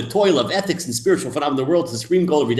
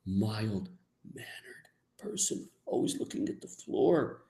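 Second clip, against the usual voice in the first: mock meek whisper; 12 dB quieter than usual. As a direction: shrink into a mousy whisper. Mocking meek piety.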